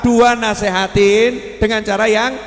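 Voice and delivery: loud, high fiery sermon; loud preacher counting out the steps; preaching at full force. Acted. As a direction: drive each point home to the crowd.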